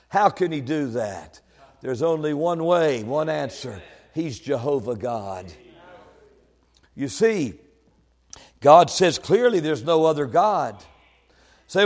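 ordinary talk, nothing marked

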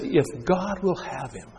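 slow, soft, high sneer; sneering taunt. Acted. Crowd at the cross, soft scornful sneer. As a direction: let the scorn drip quietly.

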